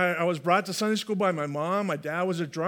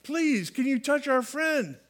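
higher-pitched, drawn-out acted pleading; imploring on someone's behalf; begging; 7 semitones higher, slower than usual. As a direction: plead on each "please", pitch swooping down. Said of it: "They were saying, begging Jesus".

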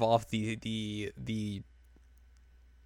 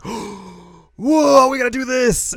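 much higher and louder, thrilled yell; excited shout; 11 dB louder, much slower than usual. Retold or imitated. Yui thrilled about a rain plan, excited shout. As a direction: burst out with sudden delight at the idea.

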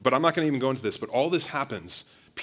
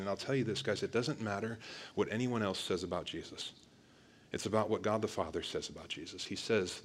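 usual speaking voice

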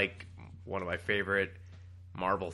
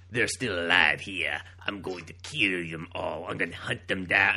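slow, lower put-on evil elf voice; silly villain voice; 3 semitones lower, 5 dB louder than usual. Reading Nightcrawler's shitty dialogue aloud as a hammy villain. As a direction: ham up a petty cartoon villain. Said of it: "that's how he sounds in my head".

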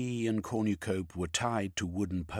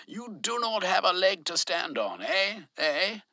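taunting; 14 semitones higher, 6 dB louder than usual. A narrator's reading. Velveen taunting overseer.

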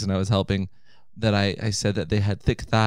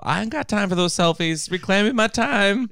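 sassy Maxine Waters; 10 semitones higher than usual. Pretend. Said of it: "she was telling people".

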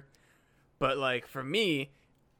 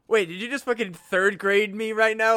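mock baffled; 5 semitones higher, 6 dB louder than usual. Baffled opponent's reaction.

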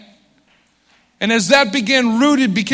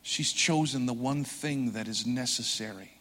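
hushed, tender sermon voice, softer; soft intimate preaching; 9 semitones lower, 13 dB quieter than usual. Acted. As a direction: draw them in quietly, like sharing a secret.

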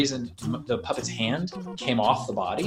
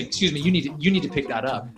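stern; 4 semitones higher, 4 dB louder, faster than usual. Retold or imitated. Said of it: "I stand up to him and I say".

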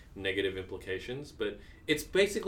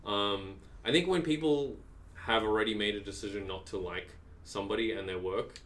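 ordinary delivery

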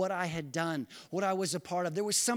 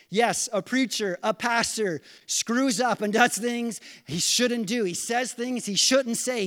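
louder, higher preaching push; fervent preaching; 5 semitones higher, 6 dB louder than usual. Real, not acted. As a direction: drive the point home from the pulpit. Preaching at full force.

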